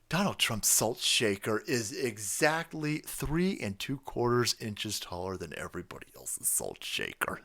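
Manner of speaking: ordinary talk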